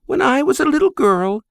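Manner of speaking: high, tender mother's voice; fond Greek mother. Acted. Mother fondly compares her son to his great-grandfather. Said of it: "she began".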